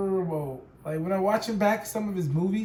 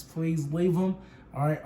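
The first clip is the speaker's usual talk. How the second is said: normal talking voice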